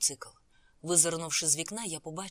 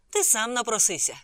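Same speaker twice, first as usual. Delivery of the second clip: loud, higher amused retort; a touch of laughter, voice raised; amused jeer; 4 semitones higher, 9 dB louder than usual. A narrator's reading. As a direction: toss it out loudly as if laughing. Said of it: "сміявся Фарід".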